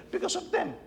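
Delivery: high, pleading Pilate quote; lightly voiced Pilate protesting; voicing Pilate. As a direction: raise the voice as the nervous governor.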